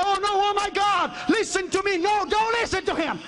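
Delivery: high, pleading cry to God; frightened man praying in terror; panicked prayer. Retold or imitated. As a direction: beg God to listen.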